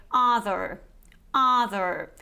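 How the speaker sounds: other in an American accent, R sounded at the end; American variety. Shown as a demonstration. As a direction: say other the American way, "UH-ther" with final R. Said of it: "in the American variety".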